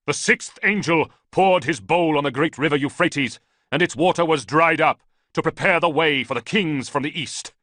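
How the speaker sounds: loud, high preaching over the clash; shouting scripture. A narrator's reading. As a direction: make the prophecy carry across the battle. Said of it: "He was shouting, his voice carrying".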